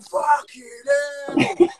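slow, high, shouted curse; startled swear. Retold or imitated. Retelling the gecko scare, a slow high startled shout. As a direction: blurt it out in fright. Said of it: "and I was, I got".

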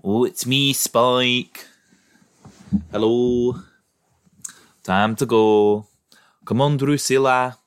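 put-on Northern English accent; British northern accent. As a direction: play Spike with a broad Northern British accent. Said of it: "a British northern accent, where they're like".

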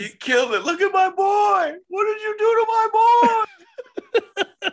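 high, loud wailing shout, laughing through it; mock distraught fan. Co-host mocking a heartbroken Cena fan, high wailing shout. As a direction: wail like a heartbroken fan whose hero lost.

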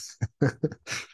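quick airy burst of laughter; breathy laugh. Real, not acted. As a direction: laugh under your breath at them. Laughing at angry rivals.

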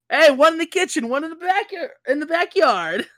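high-pitched, eager shout-back; Archie. Acted. Host answering where the kegs go, high mock teen voice. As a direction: call it out high, as a party host. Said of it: "Archie says".

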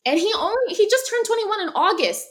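high, loud burst of amazement; amazed exclamation. Real, not acted. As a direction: exclaim it loudly, voice shooting way up. Amazed at player's young age.

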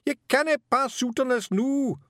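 very high, loud, desperate Scots cry; frantic high-pitched Feegle; Scottish scream. A narrator's reading. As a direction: scream at her to stay awake. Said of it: "Rob screamed".

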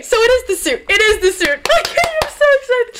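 high-pitched, giddy squealing; so excited. Real, not acted. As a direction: build to a thrilled high squeal.

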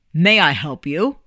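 a hint of a brisk ticket seller; ticket agent. A narrator's reading. Ticket agent greeting the boy at the counter, lightly voiced clerk. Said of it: "the ticket agent said".

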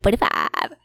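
loud, abrupt "Forty-five"; imitating the teacher. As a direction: mimic the teacher, blurting it out. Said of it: "our stupid teacher, who we're calling Mister Forty-five, said".

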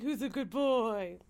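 slow, cooing pet voice; slow doggy baby talk. Retold or imitated. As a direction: coo it like talking to a puppy. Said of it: "be like".

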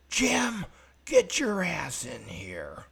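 high, loud summons, second word group drawn out; retold angry yell. Boss summons Jim into his office, angry yell. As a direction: yell it like a boss calling someone in. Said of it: "I heard some yelling".

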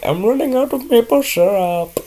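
high-pitched put-on Indian accent. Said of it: "I don't know why I did the accent".

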